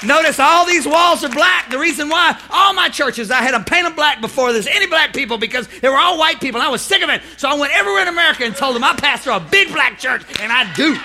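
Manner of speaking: loud, high, driving sermon; full-force preaching. Real, not acted.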